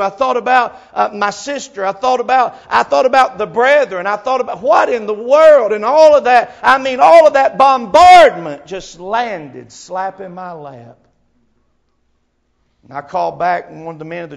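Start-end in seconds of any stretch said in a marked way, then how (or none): 0.0-11.0 from level telling into loud preaching; mounting preacher's fervor, louder each phrase; building sermon. Acted. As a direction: climb toward a full preaching cry.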